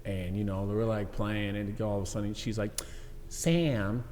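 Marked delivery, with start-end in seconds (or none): 3.3-4.0 lightly voiced wheedling wife; badly imitated wife asking sweetly; terrible wife impression. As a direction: coax slowly, pitch swooping up. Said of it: "This is terrible, uh, impression".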